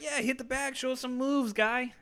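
high, put-on egging-on voice; high mocking voice. Friends egging the kid to hit the bag, in a high mock voice.

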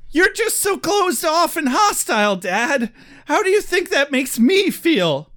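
high, whiny mock accusation; whiny son. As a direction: whine it at Dad in hammed-up hurt.

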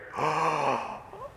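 a sudden awed intake of breath; sharp gasp. Pretend. Believer waking in heaven before Jesus, awed gasp. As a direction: gasp as if seeing him for the first time. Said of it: "you are going to suck air".

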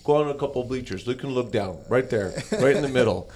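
imitating a carefree kid at the game; nonchalant. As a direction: ask it offhand, as if the news were trivial.

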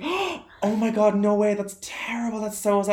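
high, slow, breathless mock shock; gasping amazement. Friend retelling a tourist's shocked reply, high mock gasp. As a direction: play the gullible listener, wide-eyed.

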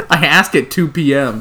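usual speaking voice

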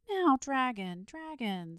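high, loud plea that falls away; plead, coaxing on the repeated name; imploring. A narrator's reading. Boy begging the reluctant dragon, imploringly. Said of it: "said the boy imploringly".

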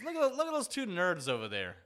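lightly voiced proud friend pointing out nerds; a hint of triumph, like a rare find; proud quote. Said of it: "very proud".

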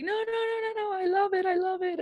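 high, softened mock-weepy cry; high wail of happy tears, acted out; tearful reassurance. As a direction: imitate a client gushing through tears. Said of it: "then she started crying".

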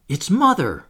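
high, loud exclamation; excited cry. A narrator's reading. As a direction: cry out at spotting her. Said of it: "cried Wendy".

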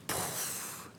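soft voiceless puff for the empty bag; poof of air. Retold or imitated. Sound of the empty bag, a breathy poof.

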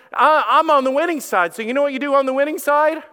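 high, loud and far brighter, rushing into the last line; triumphant preacher shout; fired-up boast. Retold or imitated. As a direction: crow it like you already won the fight. Preaching victory over cancer.

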